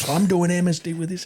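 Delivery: mock outrage, voice lifted without shouting; furious. Mate raging about the officer, retold fury. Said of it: "He was so furious".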